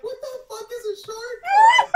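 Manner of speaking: retelling own baffled reply; laughing mock outrage. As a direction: shout it while cracking up. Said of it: "And I'm like".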